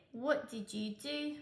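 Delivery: usual speaking voice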